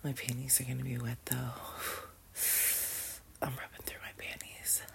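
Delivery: hushed, intimate whisper; barely voiced, breathy confession; soft whisper. Real, not acted. Aroused, touching herself.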